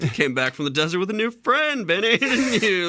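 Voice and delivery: high, cute sing-song menace with a laugh; sweetly threatening, sing it up high; sing-song threat. Retold or imitated. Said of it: "threaten him in a cute little sing-song voice".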